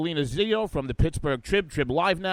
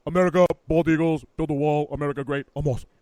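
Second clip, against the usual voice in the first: Donald Trump; 6 dB louder, faster than usual. Acted. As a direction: toss off slogans as a mock big-shot. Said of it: "So the Donald, you know".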